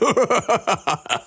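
high, smug frog laugh; loud frog laugh. Acted. Frog creature laughs at his own cleverness, smug high laugh. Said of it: "Das Froschtier lacht laut".

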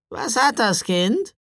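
high, slow croaking woman; creaky, sinister old crow of a voice; raven-like croak. A narrator's reading. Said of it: "ihre Stimme krächzte wie die eines Raben".

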